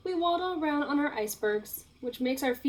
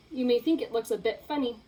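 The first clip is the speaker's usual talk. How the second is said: ordinary delivery, nothing marked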